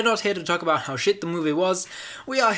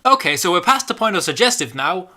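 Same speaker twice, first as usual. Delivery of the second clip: exasperated outburst; 5 semitones higher, 8 dB louder, faster than usual. Real, not acted. Exasperated loud comment.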